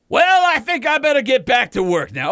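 high, loud mock Southern accent; Southern drawl. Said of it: "that's still Southern".

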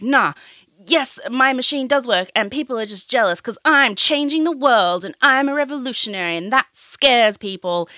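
imitating an indignant, boastful denial; Elizabeth Holmes. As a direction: come out swinging, mock self-important. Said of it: "Elizabeth comes out swinging".

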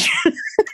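high-pitched laugh; amused high laugh. Real, not acted. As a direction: burst into a short, high laugh. Host laughs at own remark.

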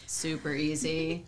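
slow, smooth radio host delivery. Real, not acted. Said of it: "You sound like a radio host when you say that".

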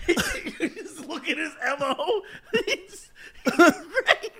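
high, giggly, breaking up; laughing while talking. Real, not acted. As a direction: choke out words between laughs. Laughing through words.

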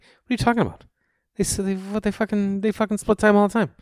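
high, exasperated rant; mad. Retold or imitated. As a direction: imitate a worked-up hockey fan. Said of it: "He gets so mad".

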